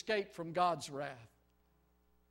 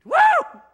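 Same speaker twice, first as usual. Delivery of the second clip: loud, high holy roller whoop; joyful whoop; 22 dB louder than usual. Real, not acted. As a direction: let out a big excited woo. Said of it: "holy roller rises up in me".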